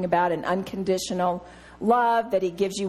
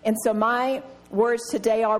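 ordinary talk

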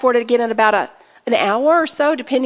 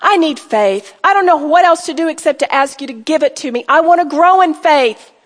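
modelled prayer; 2 semitones higher, 4 dB louder than usual. Shown as a demonstration. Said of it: "Say the truth".

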